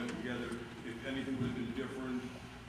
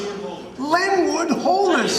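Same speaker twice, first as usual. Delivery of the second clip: much higher, far louder and very slow, laughing through it; grand name announcement; 18 dB louder, much slower than usual. Real, not acted. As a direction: proclaim your own name like a ringside announcer. Announcing his own name grandly.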